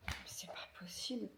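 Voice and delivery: unvoiced whisper of disbelief; whispered dismay. Real, not acted. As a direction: breathe it out in disbelief. Dreaded weigh-in, whispered dismay.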